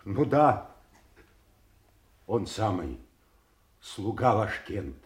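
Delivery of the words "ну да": high, loud burst of recognition; eager confirmation. Acted. Servant revealing himself to his king, eager and loud.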